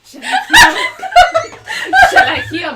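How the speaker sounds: squeaky giggling Romeo; laughing squeak. Acted. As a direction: break up laughing mid-line. Laughing high squeaky delivery.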